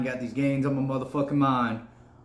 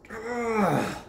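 pained cry; 8 semitones higher than usual. Real, not acted. Getting out of cold.

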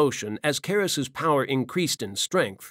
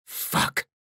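a hissed, breathless curse under the breath; whispered curse. Acted. Valen over dead Benares, a whispered curse. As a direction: bite it off without voice.

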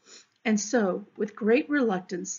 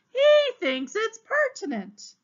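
high, slow, sneering repeat of "He thinks it's pertinent"; mocking echo; 14 semitones higher, 6 dB louder, slower than usual. The exasperated captain mocks Leo Matinet in a high, slow sneer. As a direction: parrot his words back in a high, slow sneer.

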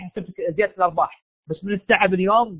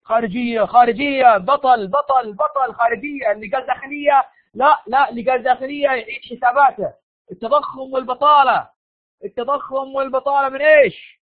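eager quiz; 6 semitones higher than usual. Real, not acted. Teacher quizzing class eagerly.

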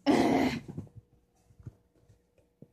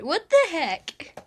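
high, loud, slowed outburst; shocked by the glowing eyes; much higher loud slow cry of shock; startled exclamation; 8 semitones higher, 10 dB louder, slower than usual. Real, not acted. Cat's eyes glowing red.

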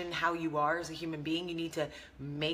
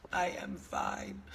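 much lower, slow put-on deep voice; deep fine; slower than usual. After the squeaky bit, answering in a slow deep voice. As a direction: drop to a slow, deep bass. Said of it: "I'm Ross Geller".

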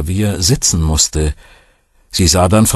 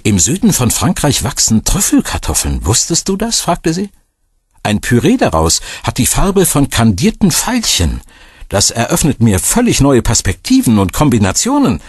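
higher, eager and bright-voiced with joy; narrator's Greta thrilled by violet-coloured potatoes, pitched up with excitement; excited delight; 6 semitones higher than usual. A narrator's reading. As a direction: gush over the recipe discovery. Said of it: "geriet sie sogar in freudige Erregung".

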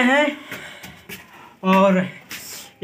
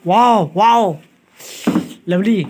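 delighted wow; 5 dB louder, slower than usual. Real, not acted. Sees the big pile of snacks.

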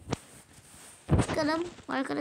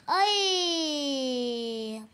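exasperated cry. Real, not acted. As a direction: wail it out in frustration. Game frustration cry. (uzun hayal kırıklığı çığlığı)

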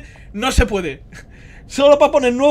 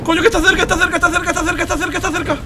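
high-pitched, breakneck repetition; frantic panic; 7 semitones higher than usual. Real, not acted. Streamer fleeing a monster that is close, frantic. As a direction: babble urgently with it right behind you.

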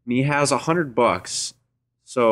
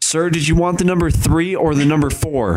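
drive-thru worker voice; 7 semitones higher than usual. Pretend.

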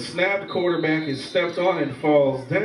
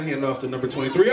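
ordinary talk, nothing marked

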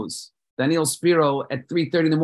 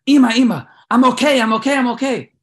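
high, loud urgent reassurance; son calling out; 10 semitones higher, 8 dB louder than usual. Acted. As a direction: shout it up high to reach Mom fast. Son calling mother after attack.

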